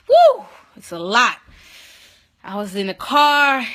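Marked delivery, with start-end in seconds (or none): 0.1-0.4 piercing, overwhelmed "woo"; sudden release of a whoop; loud high whoop. Real, not acted. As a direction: let out a big high woo. Overwhelmed exclamation telling story.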